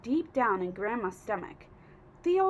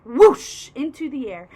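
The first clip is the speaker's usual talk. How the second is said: ordinary delivery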